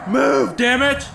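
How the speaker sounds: high-pitched urgent yell. A narrator's reading. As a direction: yell it as you charge into a crowd.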